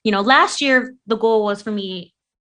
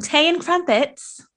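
tea and crumpets in a light, higher British accent; 7 semitones higher than usual. Shown as a demonstration. Fan of Ted Lasso testing her British accent. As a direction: try out a posh-sounding British tea and crumpets. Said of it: "Did I say that right?".

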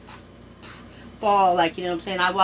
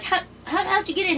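much higher, stammering surprise; shocked disbelief; 13 semitones higher than usual. Retold or imitated. Girl stunned when her brother gets in, high and stammering.

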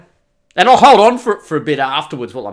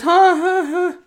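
nervous gulp; 12 semitones higher, slower than usual. Retold or imitated. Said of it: "she goes".

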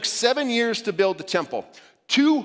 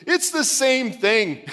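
high, slow, wailing like bursting into tears; crying wail; 7 semitones higher, 4 dB louder, much slower than usual. Retold or imitated. Wife bursting into tears at husband, slow high wail. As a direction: stretch it out in a mock tearful wail. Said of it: "as she burst into tears".

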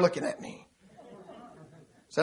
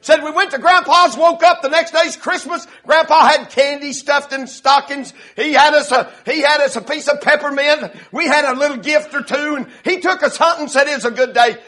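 loud, raised preaching voice; preaching storytelling; 7 semitones higher, 8 dB louder than usual. Retold or imitated. Preacher retells a boyhood Christmas to the congregation, loud preaching. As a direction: tell it high and strong from the pulpit.

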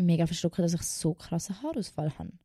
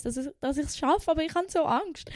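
rising whine; 8 semitones higher than usual. Retold or imitated. Explaining her fear to teacher.

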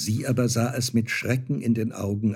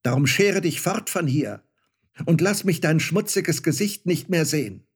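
high, louder mock-furious outburst; angry shout; 6 semitones higher, 3 dB louder than usual. Star child rejecting his mother, shouting angrily. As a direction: snap at her in outrage. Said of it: "schrie das Sternenkind zornig".

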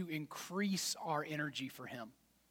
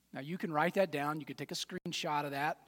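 ordinary delivery

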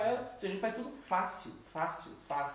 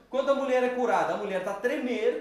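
laughing; 6 semitones higher, 8 dB louder than usual. Real, not acted.

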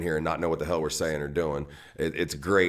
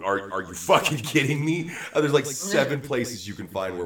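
incredulous; 7 semitones higher, 3 dB louder than usual. Real, not acted. Indignant reply to excuse.

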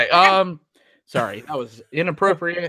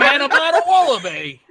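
higher, laughing mock Australian accent; attempted Australian accent; 9 semitones higher, 3 dB louder than usual. Shown as a demonstration. Joining the co-hosts' accent attempts, joking Australian accent. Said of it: "Can you guys do an Australian accent?".

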